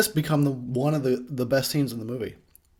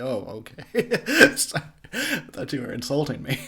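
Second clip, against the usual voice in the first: sheepish okay; 3 semitones higher than usual. Retold or imitated. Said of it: "Oh, he's like".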